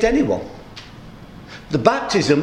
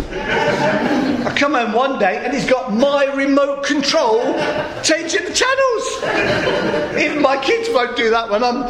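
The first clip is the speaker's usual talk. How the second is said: laughing, pitch climbing high; 7 semitones higher than usual. Real, not acted. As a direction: laugh through the telling. Laughing at students' cheek.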